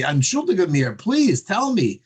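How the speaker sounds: put-on high, pleading Yiddish-accented cry; pleading belt. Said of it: "he belted me".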